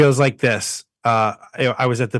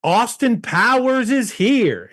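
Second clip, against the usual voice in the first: much higher, slow, giddy starstruck cry; starstruck fan; 12 semitones higher, slower than usual. Retold or imitated. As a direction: blurt it out like a dazzled fan, stretched slow. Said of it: "he goes".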